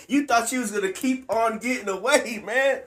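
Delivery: real gleeful laughing; laughing gleefully. Real, not acted. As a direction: laugh while reading it out. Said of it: "I'm sorry I'm laughing".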